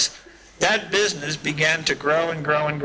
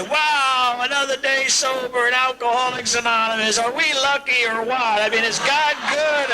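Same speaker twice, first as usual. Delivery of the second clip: beam with glee over sobriety; joyful; 9 semitones higher than usual. Retold or imitated. Dying man's joyful outburst.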